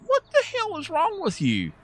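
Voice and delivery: high, strained mock snap at her; exasperated high retort. To the whining girl, high exasperated snap. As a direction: squeak it out like you've had enough.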